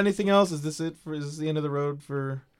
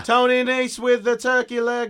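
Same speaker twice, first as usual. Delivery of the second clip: high, loud, stretched sports call; darts announcer; 11 semitones higher, 7 dB louder than usual. Acted. Riffing on Nigel's commentary, high darts-announcer shout. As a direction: announce it at full voice, pitched way up. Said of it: "He sounds like a darts announcer".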